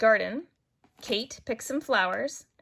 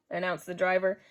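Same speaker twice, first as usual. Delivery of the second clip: ordinary delivery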